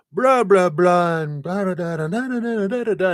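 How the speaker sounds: high, loud blah-blah rant; mock complaining rant. Mocking her own complaining to a friend, high and loud. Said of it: "I'm all complaining about something at work".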